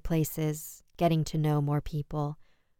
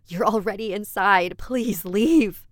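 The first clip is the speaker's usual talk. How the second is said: raised, desperate plea; higher and louder, pleading under threat; shout; 5 semitones higher, 7 dB louder, slower than usual. A narrator's reading. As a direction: shout at the intruder to go. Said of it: "I shouted at him".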